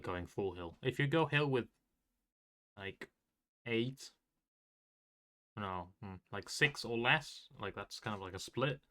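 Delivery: ordinary talk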